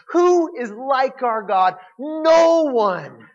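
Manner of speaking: proclaiming. Acted. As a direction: build to a triumphant answer. Preacher's rising proclamation.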